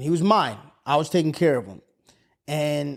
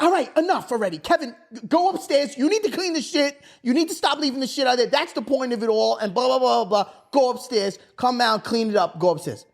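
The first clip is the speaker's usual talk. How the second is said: higher-pitched mock telling-off; imitated scolding mom; 6 semitones higher than usual. Mom sending Kevin upstairs, mock scolding. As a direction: rattle off orders like an exasperated mom. Mom ending argument with orders.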